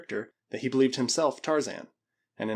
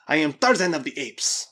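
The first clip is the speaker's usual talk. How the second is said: loud, raised, insistent declaration; mock loud scream; 4 semitones higher, 6 dB louder than usual. A narrator's reading. Mad Spaniard repeats his claim, a restrained scream. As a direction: proclaim it louder, pitch lifted. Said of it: "repeated in a loud scream".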